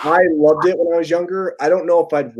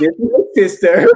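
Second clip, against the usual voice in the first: higher, louder imitated character voice; Borat; 4 semitones higher, 5 dB louder than usual. Retelling Borat introducing his sister, in his voice. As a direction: proudly present someone in a put-on foreign voice. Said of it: "and then he goes".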